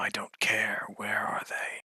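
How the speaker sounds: soft, voiceless whisper. Acted. Totem demanding to know where they are, whispered. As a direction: whisper it right into their head. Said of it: "whispers into your head".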